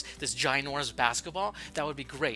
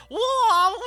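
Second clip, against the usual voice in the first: excited whoa; 12 dB louder than usual. Acted. Showing off puppet.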